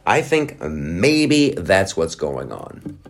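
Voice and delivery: slow, deliberate repeat. Real, not acted. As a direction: draw the words out slowly.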